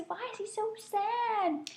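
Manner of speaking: put-on a soft, high question for the kids. Shown as a demonstration. Teller asking the children why the gator is sad, soft and high. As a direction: ask it gently and high. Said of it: "I'm gonna say".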